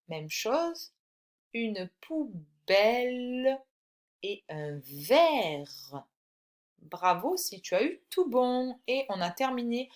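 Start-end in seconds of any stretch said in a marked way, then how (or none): 1.5-6.0 very slow, long-held final syllables; slow dictation. Shown as a demonstration. Teacher gives the correction, slow dictation. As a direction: read each word out slowly, stretching its ending.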